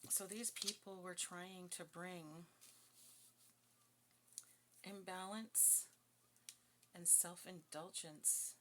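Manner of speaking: drawn out slow reading; slow each word down for weight; deliberately slow, weighty reading; slow emphasis. Real, not acted.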